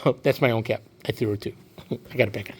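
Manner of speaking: low, soft aside; quiet, low personal comment; offhand aside. Real, not acted. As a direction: drop out of the story and mutter it.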